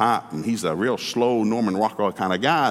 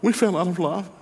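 lightly voiced Cajun wife, pitched up; higher wife voice; 7 semitones higher than usual. Pastor voicing the Cajun wife saying they fell out of love. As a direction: play the wife, pitched up and matter-of-fact.

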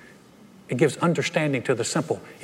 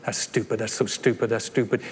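softer, lower head-shaking scorn; dismissive mutter; 4 semitones lower, 3 dB quieter than usual. Retold or imitated. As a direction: mutter it disapprovingly. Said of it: "shaking your head going".

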